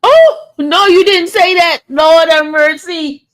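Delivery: loud, high shocked exclamation, slow on Lord have mercy; shocked outcry. Real, not acted. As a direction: cry out in disbelief at the comment. Reacting to shocking comment.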